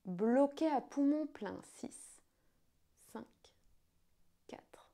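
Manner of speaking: slow, hushed guided breathing count. Acted.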